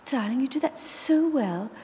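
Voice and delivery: put-on high, sweet, girlish voice with a hidden sting; sugary compliment that is really an insult; high girlish lady voice, mock sweet; posh grandmother. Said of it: "and she".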